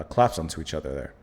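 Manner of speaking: ordinary talk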